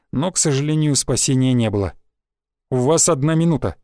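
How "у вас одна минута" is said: plain reading voice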